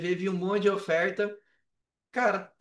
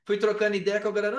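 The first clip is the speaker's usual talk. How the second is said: normal talking voice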